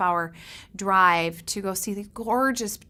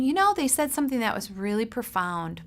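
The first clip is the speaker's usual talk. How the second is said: ordinary delivery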